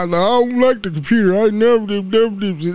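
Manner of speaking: lightly mimicked grumbling old man; mock old man. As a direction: imitate an old man's quick refusal, only lightly. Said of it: "that's that old man shit".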